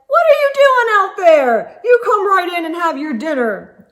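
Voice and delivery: high, loud grandpa call from inside. Acted. Poppy calling through the window to the child outside, loud and playful. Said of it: "Poppy says".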